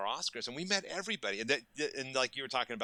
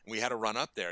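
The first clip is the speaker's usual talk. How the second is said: ordinary talk, nothing marked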